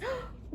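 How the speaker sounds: sudden high startled gasp; sharp gasp. Acted. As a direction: gasp as if you heard something. A sound in the storm.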